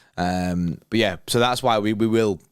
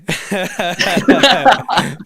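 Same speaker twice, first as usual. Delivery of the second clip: loud laugh; 11 semitones higher, 9 dB louder than usual. Real, not acted. Laughing at Hugh paying.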